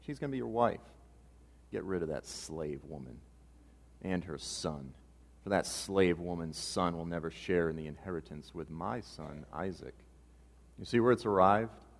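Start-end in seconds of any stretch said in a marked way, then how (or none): 1.7-9.9 quiet, low-pitched order to cast someone out; low, hushed demand. Acted. Sarah tells Abraham to get rid of Hagar, low and hushed. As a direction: press the demand softly and low.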